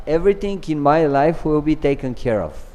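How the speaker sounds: ordinary talk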